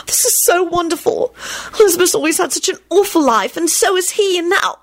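high-pitched, overwrought joy; tearful enthusiasm. A narrator's reading. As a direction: gush sentimentally, close to tears. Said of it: "tears sprang up in her eyes".

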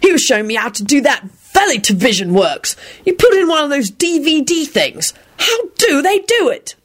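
rising, high-pitched wonder; fascinated. A narrator's reading. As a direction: marvel like an eager amateur. Arthur marvels at Muggle gadgets.

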